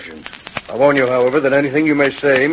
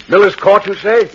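louder, higher, excited repeat; raised voice of sudden realization; startled recognition; 6 semitones higher, 6 dB louder than usual. Acted. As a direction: pounce on the name in surprise. Inspector suddenly recognizes the address.

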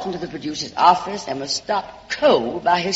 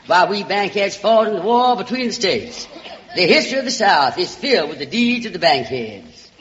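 Southern belle voice; 4 semitones higher than usual. Acted. Alabama girl sweet-talks the producer's secretary, in a Southern belle voice. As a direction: play the small-town Alabama charmer calling everyone sugar and darling.

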